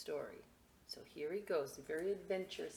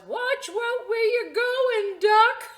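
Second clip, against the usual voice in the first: chicken's shrieking thought; 15 dB louder, slower than usual. Acted. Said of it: "what she thought was".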